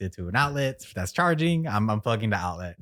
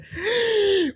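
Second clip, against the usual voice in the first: mock gasp; 5 dB louder than usual. Mom hears the swear.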